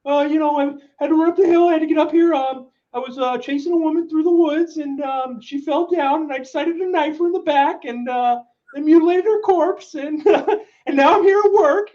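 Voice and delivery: high, monotone put-on coworker voice; hesitant high-pitched impression, matter-of-fact about horrors; Steve. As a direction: imitate an oddball reporting in, pitch pushed way up and held level. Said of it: "in, like, version of Steve".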